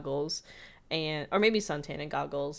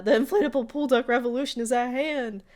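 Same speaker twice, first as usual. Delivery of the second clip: louder, higher rallying cry; rousing proclamation; 7 semitones higher, 8 dB louder than usual. Retold or imitated. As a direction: declare it like a revolutionary. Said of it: "So Seuss is like".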